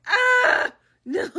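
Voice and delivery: high, loud dismayed "Ah, no"; horrified reaction. Retold or imitated. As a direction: react in mock horror. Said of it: "And I'm like".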